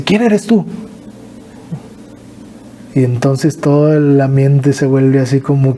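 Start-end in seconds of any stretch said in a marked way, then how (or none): 0.0-0.7 higher, bewildered question; confused mother. Retold or imitated. Mother not recognizing her son, bewildered and higher. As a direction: ask it like she truly doesn't know him. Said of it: "me dice".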